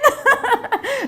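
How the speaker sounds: high, loud peal of laughter; real hearty laugh; big laugh. Real, not acted. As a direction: burst out laughing at the punchline. Laughs at the punchline.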